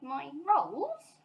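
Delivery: baffled bear; mildly surprised bear. A narrator's reading. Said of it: "looking most surprised".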